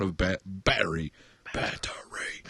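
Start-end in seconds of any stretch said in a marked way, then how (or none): 1.5-2.4 breathy whispered aside; whispered echo. Pretend. Host repeating the charge, whispered aside. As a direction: whisper the word like a punchline.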